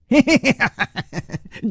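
high, scoffing laugh; a burst of high derisive laughter; mocking laugh. Character scoffs at claimed evidence.

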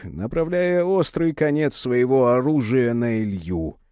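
ordinary delivery, nothing marked

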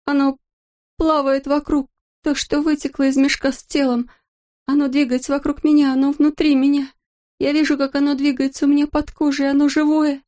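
spiral into screaming horror; rising terror. A narrator's reading. Hallucinating astronaut in terror.

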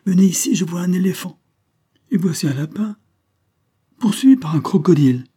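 crié fort, nettement plus aigu; appel crié. A narrator's reading. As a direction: appeler les autres vers sa fenêtre. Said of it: "cria une troisième personne". (un invité crie ce qu'il voit, voix forte et aiguë)

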